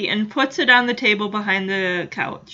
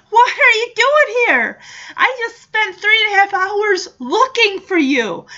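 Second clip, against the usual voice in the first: much higher and louder, worked-up rebuke; father figure scolding a runaway kid, raised voice; exasperated scolding; 9 semitones higher, 5 dB louder than usual. Retold or imitated. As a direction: let the worry burst out as anger. Henry scolding Punky, voiced.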